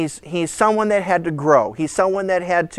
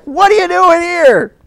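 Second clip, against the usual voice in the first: loud, high mock scolding; exasperated parent; 11 semitones higher, 6 dB louder than usual. Parent finding a wandering kid, hammed-up exasperation. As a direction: bawl out the kid as a fed-up parent. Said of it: "I can relate as a parent when you're like".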